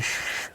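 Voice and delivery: hushed, hissing "shh"; annoyed shush. Retold or imitated. An annoyed audience member shushes the man beside him, sharp hush. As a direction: shush a noisy neighbour. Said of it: "looked daggers at me and said".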